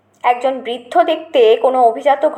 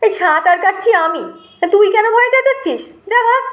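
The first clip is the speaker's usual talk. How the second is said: scoffing elder brother; 7 semitones higher than usual. A narrator's reading.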